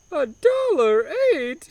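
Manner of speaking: put-on high, slow Italian-accented disbelief at "A dollar eight?"; mock surprise. Humdinger repeating the price in mock Italian shock. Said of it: "obnoxious broken Italian accent".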